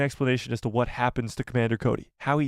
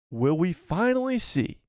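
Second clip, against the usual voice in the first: usual speaking voice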